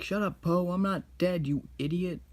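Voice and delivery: a kid voicing a cranky old master; grumpy master. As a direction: snap at Po in play. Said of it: "And then he's like".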